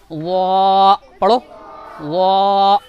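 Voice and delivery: वा drawn out thick and rounded, slow; heavy zoa. Shown as a demonstration. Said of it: "मुंह को गोल करके मोटा करेंगे".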